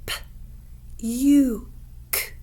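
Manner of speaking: "P, U, K" split into separate sounds; sounds tapped out. Shown as a demonstration. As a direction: tap out each sound of "puke". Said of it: "Where do you hear the U sound".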